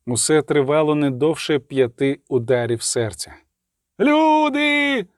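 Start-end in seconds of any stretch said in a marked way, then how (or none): 4.0-5.1 high, drawn-out call for help; crying out. A narrator's reading. Someone between houses raising the alarm, crying out. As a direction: call out high to the whole street. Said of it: "закричав хтось між будинками".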